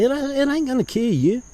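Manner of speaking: a hint of his mother, scolding high; mama's voice. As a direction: preach it like mama would. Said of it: "My mama's took over this now".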